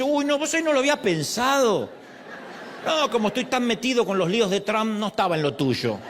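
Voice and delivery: imitación cómica y aguda de alguien sorprendido; Dios despistado en broma. Pretend. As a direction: play a mock-flustered God, starting high. (Dios despistado, en tono de burla)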